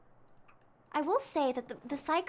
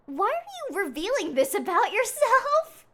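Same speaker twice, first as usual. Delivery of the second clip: incredulous; 9 semitones higher, 8 dB louder than usual. Real, not acted. Reacting to creepy NPC.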